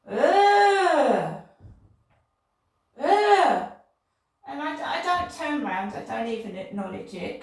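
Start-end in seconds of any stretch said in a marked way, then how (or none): 0.1-3.7 loud, high, drawn-out "ugh"; imitated cry of disgust. Woman mimicking what she heard behind her on the field, loud disgusted cry. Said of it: "and I can hear".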